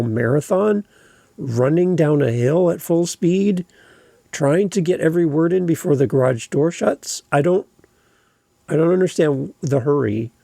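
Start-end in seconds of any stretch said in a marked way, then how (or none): none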